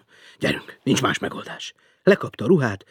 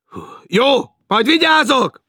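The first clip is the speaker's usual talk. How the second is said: higher, loud and slow reply; impatient shout; 11 dB louder, slower than usual. Acted. Félix answering the woman, impatient shout. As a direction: shout back to get rid of her.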